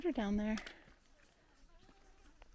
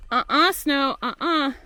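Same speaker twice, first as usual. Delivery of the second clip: loud no-no at the snow; playful refusal; 2 semitones higher, 9 dB louder than usual. Real, not acted.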